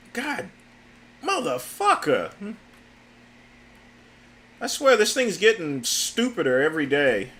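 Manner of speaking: swearing at the screen, fed up; real exasperation. Real, not acted. Frustrated user cursing a chatbot that keeps failing, exasperated.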